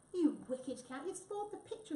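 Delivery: upset, rising-pitched telling-off; scolding. A narrator's reading. Photographer to the cat who spoiled the picture, scolding.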